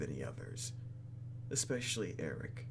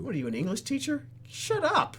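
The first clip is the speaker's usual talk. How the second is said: playful outburst; 6 dB louder than usual. Real, not acted. Mock outburst at character.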